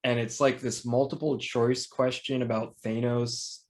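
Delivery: ordinary talk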